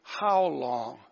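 drawn-out, burdened cry of exasperation; sighed deeply. Acted. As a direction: heave it out like a heavy sigh. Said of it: "He sighed deeply".

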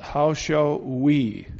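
"How shall we", drawn out slowly word by word; slow right down and lean on "we"; stress on "we". Shown as a demonstration. Rereads verse stressing the pronoun.

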